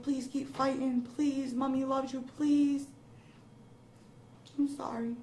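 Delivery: on the verge of breaking down; tearful pleading. Real, not acted. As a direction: plead through tears, pitch lifted. Mother retells plea, breaks down, apologizes.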